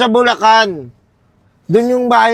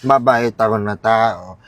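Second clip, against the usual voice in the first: put-on drunken threat; 8 semitones lower, 4 dB quieter than usual. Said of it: "Galit ka ba?".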